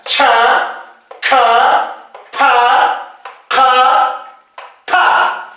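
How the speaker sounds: "Cha, ka, pa, ka, ta" with a puff of breath after each consonant; aspirated consonants. Shown as a demonstration. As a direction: release each consonant of "Cha, ka, pa, ka, ta" with audible air. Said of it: "Consonante aspirada".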